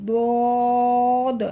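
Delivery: দদ loud, vowel held for four beats; full-mouthed, sustained "dooood" on দদ; long thick letter name. Shown as a demonstration. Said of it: "চার আলিফ টেনে". (দদ চার আলিফ টেনে মোটা করে)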